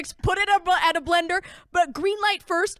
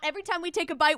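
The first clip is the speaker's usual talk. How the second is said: normal talking voice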